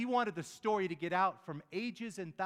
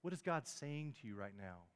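soft, lowered preacher's voice with long pauses; quiet, searching questions, unhurried between phrases; hushed reflection; 3 semitones lower, 5 dB quieter than usual. Real, not acted. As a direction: gently leave room for them to think.

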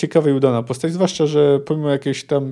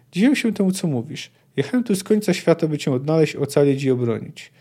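plain reading voice

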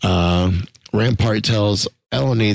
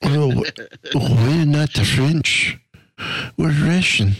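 mock French-Russian accent; 5 semitones higher than usual. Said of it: "I don't know what you were just trying to do".

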